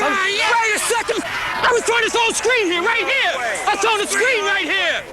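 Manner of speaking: high-pitched, insistent argument; real indignant objection across the field; heated protest. Real, not acted. Player disputes the referee's call.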